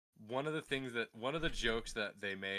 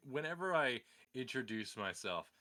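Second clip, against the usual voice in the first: normal talking voice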